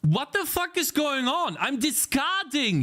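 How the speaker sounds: high-pitched disbelieving protest; exasperated outburst. Real, not acted. Coder arguing with code that keeps failing, high exasperated protest. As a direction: protest in high, frustrated bafflement.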